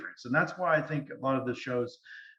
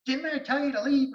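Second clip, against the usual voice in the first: much higher mock scolding ghost; imagined ghost scold; 12 semitones higher than usual. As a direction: snap it as the annoyed ghost.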